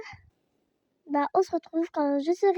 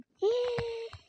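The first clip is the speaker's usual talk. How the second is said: sudden frightened gasp; sharp gasp; 7 semitones higher than usual. Real, not acted. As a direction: gasp in shock. Monster appears. (souffle coupé de peur)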